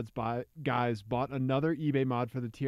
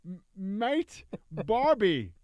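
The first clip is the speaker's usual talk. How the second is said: bad Australian accent; 5 semitones higher, much slower than usual. Shown as a demonstration. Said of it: "I'm bad at accents".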